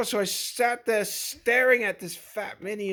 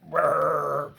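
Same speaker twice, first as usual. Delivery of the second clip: engine rumble; 7 semitones lower, 3 dB louder than usual. Acted. The mini after drinking gas.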